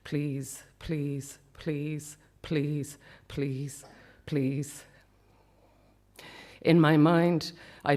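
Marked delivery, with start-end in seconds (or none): 0.0-4.8 quiet, hushed pleading, over and over; soft repeated plea. Retold or imitated. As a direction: murmur the pleas softly. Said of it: "over and over I say".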